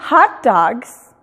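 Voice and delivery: "hot dogs" slow, in an American accent, like "hat daags"; English pronunciation. Shown as a demonstration. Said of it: "Y no es".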